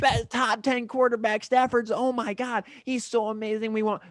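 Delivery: high gushing fan voice; mock adoration. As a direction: gush like a bandwagon fan. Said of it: "all these people are coming out of the woodwork".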